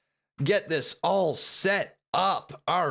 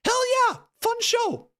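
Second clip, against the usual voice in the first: excited cheer. Real, not acted. Closing the show.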